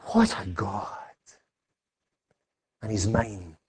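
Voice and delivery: soft, low, hushed wonder; hushed awe. Acted. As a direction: breathe it out in quiet awe. Quoted awe at God.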